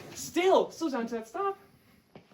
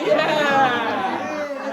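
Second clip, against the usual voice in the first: cheering; 3 semitones higher, 9 dB louder than usual. Acted. Celebrating the joke.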